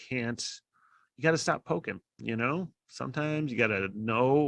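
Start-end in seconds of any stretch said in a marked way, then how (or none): none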